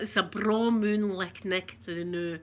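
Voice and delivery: slow, drawn-out broad Scots phrase. Shown as a demonstration. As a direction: lay on the Scots slowly. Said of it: "People think we say that".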